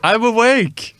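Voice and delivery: high, loud jolt awake; startled awake. Acted. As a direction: wake up with a shout. Mock wake-up show opening.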